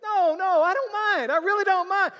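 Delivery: very high, loud flustered refusal; flustered protest. Retold or imitated. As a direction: protest too eagerly out of politeness. Speaker politely refusing comp.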